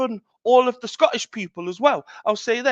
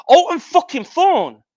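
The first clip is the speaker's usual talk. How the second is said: higher, louder, slow boastful roar of his own name; proud shout; 7 semitones higher, 9 dB louder than usual. Real, not acted. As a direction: bellow your name like a ring announcer, drawn out.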